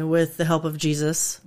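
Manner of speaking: ordinary delivery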